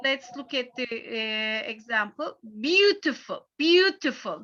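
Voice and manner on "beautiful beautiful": "Beautiful" with a higher-pitched first syllable, then falling; "Beautiful" stressed on BEAU. Shown as a demonstration. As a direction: hit BEAU in "Beautiful", let the rest drop. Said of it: "the first syllable".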